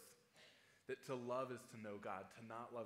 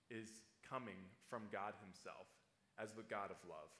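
ordinary talk, nothing marked

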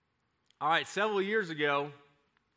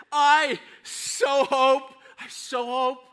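high, loud, stretched-out yearning; acted outburst of longing; fervent hope; 9 semitones higher, 10 dB louder, much slower than usual. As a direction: wish it hard, drawing out each word. Voicing the longing of believers.

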